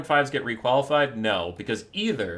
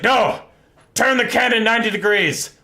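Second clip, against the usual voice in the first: loud, raised-pitch order; commanding tank driver; 9 semitones higher, 9 dB louder than usual. Acted. Tank driver overruling his gunner, commanding shout. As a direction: shout a stubborn mock command. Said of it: "and he's like".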